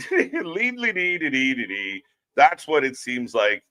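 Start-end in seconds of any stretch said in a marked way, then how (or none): 0.1-2.0 mock Irish lilt. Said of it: "words always skipping around".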